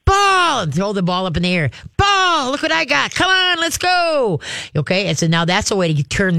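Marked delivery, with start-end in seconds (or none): 0.0-0.6 excited ball call. Shown as a demonstration. Trainer calling out "Ball" to a ball-crazy dog. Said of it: "You can go". 2.0-4.4 excited ball tease. Shown as a demonstration. Trainer tossing the ball and calling the dog excitedly. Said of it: "You can go".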